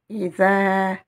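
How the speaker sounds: Ida with the last vowel held long; prolonged vowel. Shown as a demonstration. Teacher reading the prolongation, final vowel held. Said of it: "Vous avez ici la prolongation".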